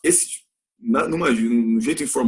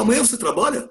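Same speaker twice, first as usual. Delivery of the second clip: casual question; 9 semitones higher, faster than usual. Shown as a demonstration. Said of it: "Mas a gente fala".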